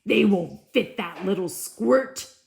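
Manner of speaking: loud, scornful jeer; sneering brothers. Acted. Brothers mocking Cinder, loud sneer. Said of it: "sneered his brothers".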